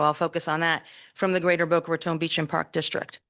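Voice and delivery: usual speaking voice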